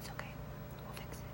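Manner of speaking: soft whisper to herself. Real, not acted. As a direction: murmur it under the breath. Reassuring baby, whispered aside.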